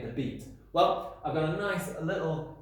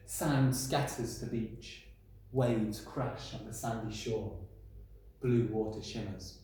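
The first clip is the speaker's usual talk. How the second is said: haiku reading; 3 semitones lower, 3 dB quieter, slower than usual. Shown as a demonstration. Reads a haiku aloud.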